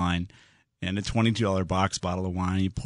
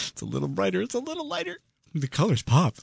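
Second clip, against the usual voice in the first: high, soft mock-fan voice through chuckles; tickled, teasing quote; giggly mockery; 11 semitones higher, 3 dB quieter than usual. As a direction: imitate gushing buyers while trying not to laugh. Mocking iPhone buyers, cracking up.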